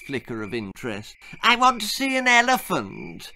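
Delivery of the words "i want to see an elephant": put-on petulant high girl's whine.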